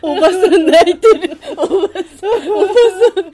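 loud laughing tease; laughing teasing. Real, not acted. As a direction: laugh while calling out.

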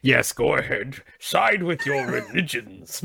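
slow, put-on pompous atheist voice; condescending atheist. Comic demonstrates how all the atheists sound, condescending. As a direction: imitate a superior skeptic, dragging each word. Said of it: "that's how all the atheists".

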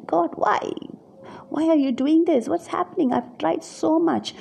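high, pained cry of why; anguished objection to God. Retold or imitated. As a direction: plead with God in frustration. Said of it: "have this sense of this objection".

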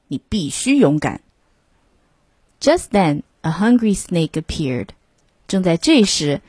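usual speaking voice

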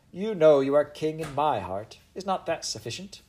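queen's voice. Acted. Queen teasing her favourite.

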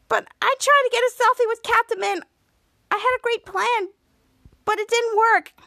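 high-pitched little-sister complaint; whiny pleading. Retold or imitated. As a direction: plead your case in a high, play-acted whine. Girl pleads her case on phone.

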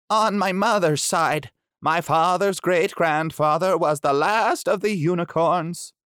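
lightly voiced shy beast; gentle creature agreeing. Pushmapulya explains ancestry.

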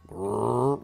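short inquisitive animal growl; curious wolf growl. Pretend. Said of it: "his growl is changed to being somewhat curious".

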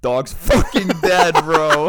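loud, high, gloating announcement; gleeful gloat. Real, not acted. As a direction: crow it out, delighted the dog is gone. Gloating over hated dog's death.

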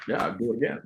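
soft, muttered agreement; quiet resolve. Retold or imitated. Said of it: "So I was like".

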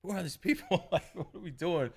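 high, hushed nervous questioning; retold scared friend. Storyteller voicing Carolyn at the strange party, lightly scared. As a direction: imitate her uneasy near-whisper. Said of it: "she gets scared easy".

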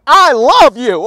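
loud, insistent reassurance; emphatic declaration. Real, not acted. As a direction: push it out loud and heartfelt. Emphatic answer to imagined doubt.

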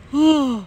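high, breathy yawn sound. Real, not acted. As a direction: let out a real yawn. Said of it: "I keep yawning".

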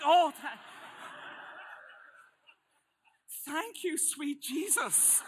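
loud, high burst, then drawn out; hammed-up relief. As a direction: cry out in exaggerated relief. Acts out relief at learning they're siblings.

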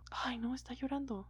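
whispered schoolyard taunt; mocking whisper. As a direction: hiss it like a kid ratting out a crier. Kids taunting a crying boy. (burla susurrada de niño)

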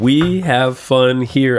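drawn-out slow motto. Acted. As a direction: say the motto slowly, savoring it. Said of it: "That's our motto".

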